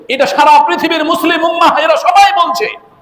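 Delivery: very high, ringing preacher's cry; impassioned proclamation. Real, not acted. Preacher claiming all Muslims agree, impassioned proclamation. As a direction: proclaim it at the peak of the voice.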